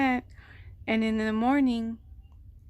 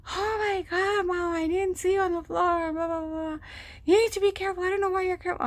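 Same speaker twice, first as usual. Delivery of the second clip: much higher mock voice of the scolding husband; 9 semitones higher, faster than usual. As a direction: play him up as a fussy, scolding man, pitched high. Husband scolding her, voiced in story.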